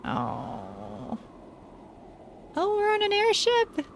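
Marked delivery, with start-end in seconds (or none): 2.6-3.9 high delighted squeal; bursting with glee at the reveal; excited. Real, not acted. Streamer thrilled to see an airship, high delighted squeal. Delighted at new game scene.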